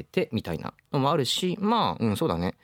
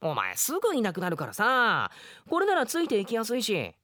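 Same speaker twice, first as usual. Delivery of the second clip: put-on bright young boy handing over a present; a cheerful kid voice, lightly acted; lively boy character; 10 semitones higher than usual. Drama scene, boy explains bell.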